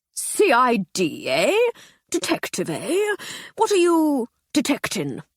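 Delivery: high, slow blustering toff; a puzzled old-boy drawling eh; captain quizzing the inspector with bluster; captain's bluster. Acted.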